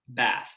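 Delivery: American "bath" with "a" as in cat; low front vowel. Shown as a demonstration. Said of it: "That's how I say it".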